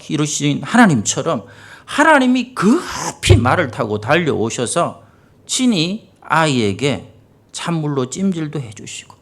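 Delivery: ordinary talk, nothing marked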